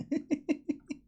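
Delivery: high mock giggle; staccato high-pitched pretend laugh; imitated laughter. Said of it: "these lot are just there like".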